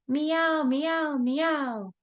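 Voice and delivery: high meowing; cat meowing. Pretend. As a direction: meow like a kitten. Cat sound in kids' rhyme.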